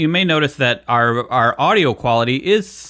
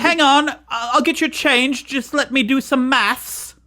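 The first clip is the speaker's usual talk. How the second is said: high mock British voice, slow at the end; mock British server; 12 semitones higher, slower than usual. Guest playing a British server counting change, high mock British. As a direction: play a fussy British shopkeeper.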